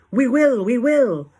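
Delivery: high, very loud chorused reply; crowd answer. A narrator's reading. Witches answering their leader, loud high cries. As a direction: shout it eagerly, twice over. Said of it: "they answered".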